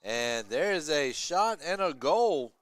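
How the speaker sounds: loud, slow goal call; excited goal call. Real, not acted. Commentator announcing a home team goal, loud excited call.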